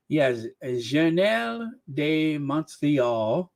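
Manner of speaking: slow, hammed-up French accent. Host showing off the newspaper name in a French accent. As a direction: savour the French name slowly. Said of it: "do this French accent".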